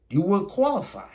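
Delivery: usual speaking voice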